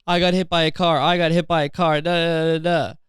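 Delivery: whiny mock-victim chant; mocking blah-blah complaint; mocking a complainer. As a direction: parrot them in a put-on whine. Mimicking a whining victim.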